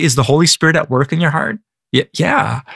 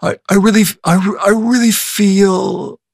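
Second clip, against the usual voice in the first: over-the-top professor; 6 semitones higher than usual. Retold or imitated. Bible college professor mocking talk of feelings, over the top. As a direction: ham it up as a snarky professor, stretching the words. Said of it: "here's my impression of him".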